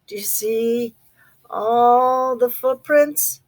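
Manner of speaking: plain reading voice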